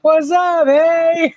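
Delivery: high goofy drugged hello; loopy greeting. Retold or imitated. To her husband from the gurney, goofy high hello. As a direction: wave him in, high and silly. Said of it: "hanging over the side of the gurney".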